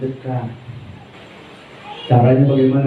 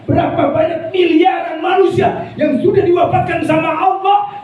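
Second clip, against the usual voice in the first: fiery preaching; 14 semitones higher than usual. Acted. Preaching at full force.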